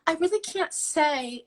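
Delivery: high, slow, mock-helpless little voice; fake damsel in distress. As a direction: imitate a coy damsel in distress. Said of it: "very fake damsel in distress way".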